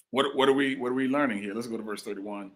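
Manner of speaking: normal talking voice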